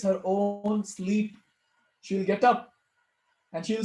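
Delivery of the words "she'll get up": ordinary delivery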